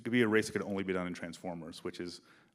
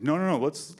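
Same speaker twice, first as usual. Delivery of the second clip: higher, louder urgent no no no; eager insistence; 6 semitones higher, 7 dB louder than usual. Retold or imitated. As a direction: stop him before he loses it. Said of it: "And I'm like".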